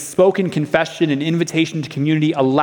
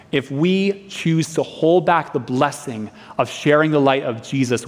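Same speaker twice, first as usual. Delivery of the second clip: usual speaking voice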